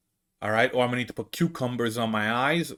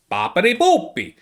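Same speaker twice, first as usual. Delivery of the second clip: bouncy, higher-pitched cartoon Italian accent; mock Italian accent; 6 semitones higher, 4 dB louder than usual. Shown as a demonstration. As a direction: exaggerate a singsong Italian accent. Parodies an exaggerated Italian accent.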